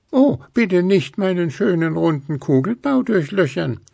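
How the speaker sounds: pleading in the snowman's voice. A narrator's reading. Ghost begs Luzi, faking the snowman's voice. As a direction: beg for your round belly. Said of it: "flehte Gruseli mit Schneemanns Stimme".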